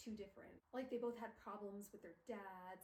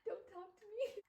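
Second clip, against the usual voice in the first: 1 crying; 10 semitones higher, 4 dB louder than usual. Real, not acted. Crying reaction to Jem's goodbye.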